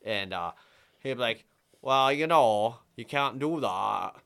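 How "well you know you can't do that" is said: loud, slow northern Vermont drawl. Retold or imitated. Courthouse guy from up north refusing him, in a northern Vermont drawl. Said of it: "they talk like that".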